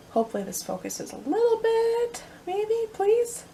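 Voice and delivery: high-pitched, squeaky coaxing; high wheedling wish; hopeful pleading. Real, not acted. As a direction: plead sweetly in a high little voice. Coaxing camera to focus.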